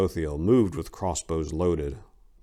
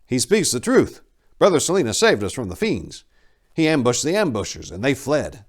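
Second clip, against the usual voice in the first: a touch higher, earnest defense; earnest monk; 6 semitones higher, 5 dB louder than usual. Acted. Dethus backing Silenus before Tor, lightly voiced earnest. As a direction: vouch for him firmly.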